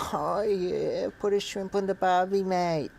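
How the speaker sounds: high, hammed-up Australian bogan accent; mock Australian accent. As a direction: lay on a cartoon Australian twang. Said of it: "I'm a bogan".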